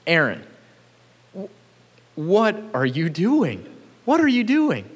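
put-on exasperated parent catching a kid red-handed; Moses confronting Aaron.

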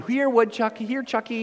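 high mock coaxing call; playful pretend call to a woodchuck. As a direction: jokingly coax the woodchuck. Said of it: "I should have been going".

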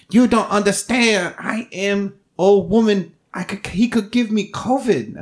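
put-on high, slow voice of an old mother; mom voice impression. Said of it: "my mom voice impression".

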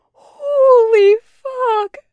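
high, loud, long-drawn exclamation; acted laughing to tears. A narrator's reading. Taco wiping his eyes after the accent, laughing to tears. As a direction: wail it out, spent from laughing. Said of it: "wiping at his eyes".

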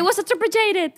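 high-pitched bilingual joke; mock schoolgirl English. Friend playing the late pupil, high mock English. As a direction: put on a kid's invented English.

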